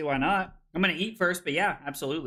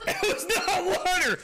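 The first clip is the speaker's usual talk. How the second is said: laughing; 14 semitones higher than usual. Real, not acted. Laughing after tasting drink.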